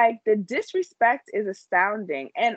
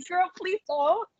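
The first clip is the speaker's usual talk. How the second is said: pleading; 8 semitones higher than usual. Retold or imitated. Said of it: "even though it's like".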